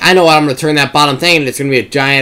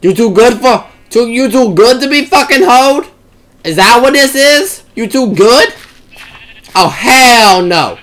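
sassy put-on voice; 7 semitones higher than usual.

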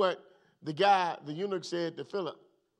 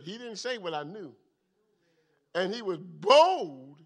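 ordinary talk

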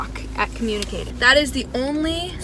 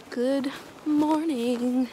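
drawn-out greeting; 3 semitones higher, much slower than usual. Real, not acted.

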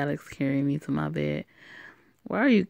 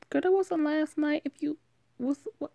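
put-on much higher girlish friend voice; friend's voice; 11 semitones higher than usual. Friend correcting her on Monday morning, high girlish voice. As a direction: pitch up into a chatty girlfriend.

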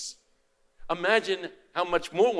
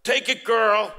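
put-on louder, higher, insistent Italian-accented urging; 5 semitones higher, 6 dB louder, slower than usual. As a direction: urge her forcefully, imitating an Italian accent. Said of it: "he said in his Italian accent".

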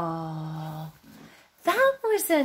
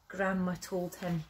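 usual speaking voice

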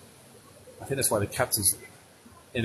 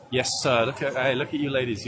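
ordinary talk, nothing marked

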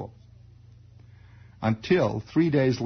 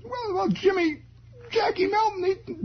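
put-on very high tearful boy voice; high crying kid stammering; kid near tears. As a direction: blubber out the excuse. Kid breaks down.

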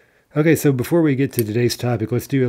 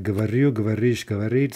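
говорю, говоришь, говорит all stressed on the ending. Shown as a demonstration. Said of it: "we say that's in stress".

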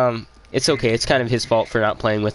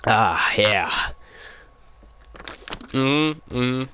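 breathy satisfied sigh after a sip; relieved ahh after gulping water; after a refreshing drink; 3 dB quieter, much slower than usual. Real, not acted. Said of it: "that water tastes good".

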